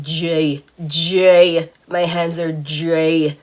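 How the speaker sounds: Bajan accent, DRY said like "dray". Shown as a demonstration. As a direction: stretch out the words in a Bajan accent. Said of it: "I have to say it in Bajan accent".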